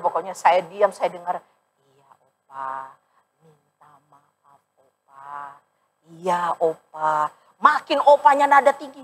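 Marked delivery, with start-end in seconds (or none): 1.8-7.3 very soft, slow, humble apology; meek and quiet. Retold or imitated. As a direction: shrink your voice and apologize slowly. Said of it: "suara saya makin pelan". (pendeta menjawab opa dengan suara pelan dan lambat)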